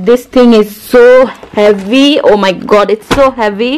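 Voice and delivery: normal talking voice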